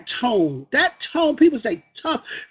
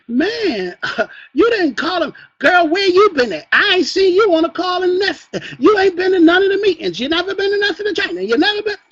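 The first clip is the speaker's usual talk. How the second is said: higher, louder, rapid-fire scolding impression; mock scolding; 7 semitones higher, 7 dB louder than usual. Leader imitates a nagging upline scolding a missing member. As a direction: nag the absent member like a bossy leader. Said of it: "You're not here to scold other people".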